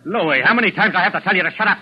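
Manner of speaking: exasperated shout. Acted. Gang boss yelling at Louis to shut up. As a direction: lose patience with him. Boss scolds henchman again.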